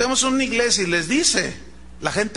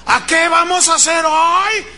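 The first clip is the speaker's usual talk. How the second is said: high, loud rallying shout; motivator; 9 semitones higher, 7 dB louder than usual. Retold or imitated. Coach quizzing sleepy staff, motivator shout. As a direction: shout the question like a sales coach. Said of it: "empecé a escuchar al motivador".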